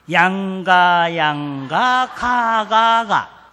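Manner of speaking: slow, loud drawn-out grades; mock-solemn readout of failing grades; dramatic reading. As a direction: spell out each bad grade for effect. Reading out son's report card.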